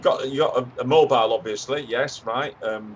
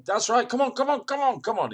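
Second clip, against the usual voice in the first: urging him on; 10 semitones higher than usual. Acted. Urging guest across car park.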